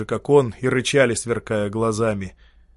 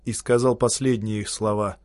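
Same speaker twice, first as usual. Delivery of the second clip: usual speaking voice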